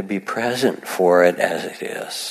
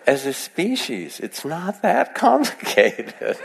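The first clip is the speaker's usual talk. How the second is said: high, slow, drawn out with laughter; incredulous, laughing high-pitched delivery; amused exasperation; 8 semitones higher, slower than usual. Real, not acted. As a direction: plead the obvious while laughing. Jokes on, laughing.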